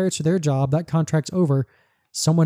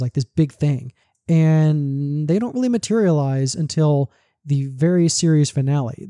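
ordinary talk, nothing marked

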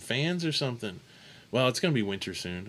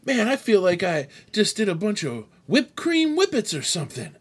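raised-pitch retelling of a drugged patient; imitated woozy patient; 8 semitones higher than usual. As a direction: imitate someone fresh out of sedation. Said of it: "He's like".

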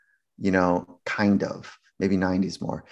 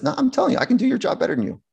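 insistent athlete; much faster than usual. Retold or imitated. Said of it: "And he says".

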